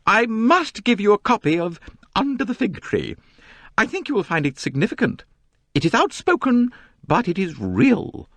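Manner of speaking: firmly self-important society lady; firm, high matron. A narrator's reading. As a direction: press the book on him firmly. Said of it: "Missus Otterbourne said firmly".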